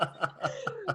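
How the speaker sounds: laughing. Real, not acted. Laughs after mock crying.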